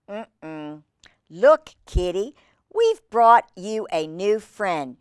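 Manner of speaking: ordinary delivery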